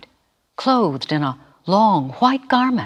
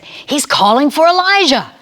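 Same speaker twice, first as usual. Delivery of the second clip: bystander; 11 semitones higher, 5 dB louder than usual. A narrator's reading. Bystander's mocking remark.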